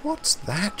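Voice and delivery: high, hushed question; whispered. A narrator's reading. Mavis hearing growling in the distance, hushed whisper. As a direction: hush it, peering into the dark. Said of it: "she whispered".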